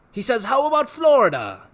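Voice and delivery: eager, wheedling showman; a salesman tempting the boy; Rictus voice. Acted. Rictus tempting Harvey with pets, eager showman voice.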